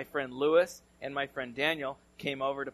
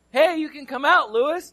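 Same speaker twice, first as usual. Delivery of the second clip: calling out; 12 semitones higher, 12 dB louder than usual. Retold or imitated. Calling to friend in barn.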